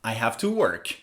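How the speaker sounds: "I have to work" low and soft, "work" falling; falling intonation. Shown as a demonstration. Teacher illustrating certainty, "work" falling. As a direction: state it as certain, dropping on "work". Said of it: "when something is certain".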